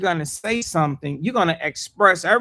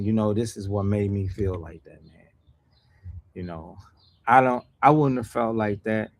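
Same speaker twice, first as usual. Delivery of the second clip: gentle apology; 9 semitones lower than usual. Acted. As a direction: say it like making peace. Voiced apology, softened.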